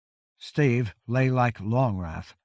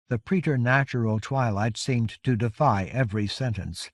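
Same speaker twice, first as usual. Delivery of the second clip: plain reading voice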